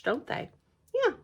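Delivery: ordinary delivery, nothing marked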